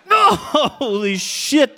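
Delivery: mock pained yelp; shocked yelp. Reliving electric shock.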